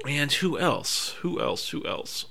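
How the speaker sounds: soft, fading self-talk; quietly asking yourself, barely voiced; musing under the breath. Real, not acted. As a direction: trail down to a near whisper while thinking. Thinking aloud, searching for a name.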